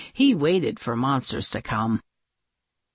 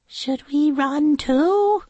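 slow, high princess question; high, drawn-out, rising query; Princess Sneezewort; 10 semitones higher, 3 dB louder than usual. A narrator's reading. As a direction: ask in a high storybook-princess voice. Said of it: "said Princess Sneezewort".

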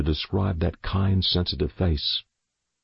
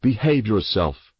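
warning; 3 semitones higher than usual. Acted. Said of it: "Father warned".

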